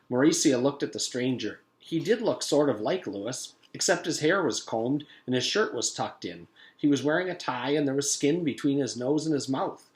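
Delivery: usual speaking voice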